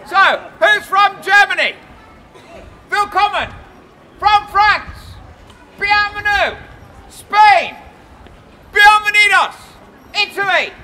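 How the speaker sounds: loud proclaiming greetings; town crier. Acted. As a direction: greet each nation like a crier.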